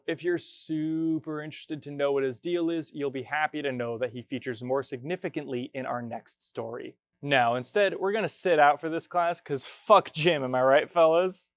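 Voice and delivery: ordinary talk, nothing marked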